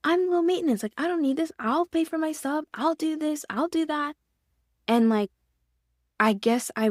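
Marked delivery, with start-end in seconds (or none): 0.0-4.2 higher, eager self-quote pleading her case; pitched-up mock eager insistence; eager protesting voice. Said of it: "I'm like".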